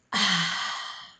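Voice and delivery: long breathy sigh out; demo sigh. Shown as a demonstration. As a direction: sigh it out audibly. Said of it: "make a big sigh sound like this".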